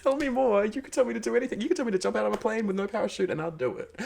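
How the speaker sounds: high breathy swoon, fast and gushing; swooning over a French voice. Retold or imitated. To every French person met, high smitten swooning. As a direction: gush like you're smitten. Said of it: "I've been like".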